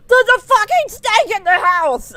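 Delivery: high, loud, panicked yell; alarmed shout. Retold or imitated. Brother running in to warn her, a high panicked yell. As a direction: burst in yelling the alarm. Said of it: "he's like".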